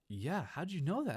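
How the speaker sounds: high, drawn-out astonished question; slow, wide-eyed mock wonder; amazed friend. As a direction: imitate a clueless kid's surprise. Friend amazed he knew the account.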